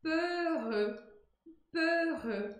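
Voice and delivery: "Peureux" rounded closed E twice; French peureux. Shown as a demonstration. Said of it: "ele aparece duas vezes". ("Peureux" com E fechado duas vezes)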